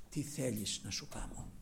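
soft, low tender asking; gentle question. Retold or imitated. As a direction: ask softly, with kindness. Lord speaking gently to blind man.

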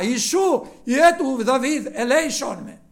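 loud pleading cry for mercy. Retold or imitated. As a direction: call out loudly, begging. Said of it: "έπιασε να φωνάζει δυνατά".

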